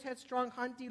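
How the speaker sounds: ordinary talk, nothing marked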